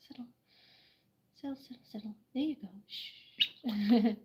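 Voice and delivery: hushed repeated "settle" to calm a bird. Real, not acted. Calming a frightened bird.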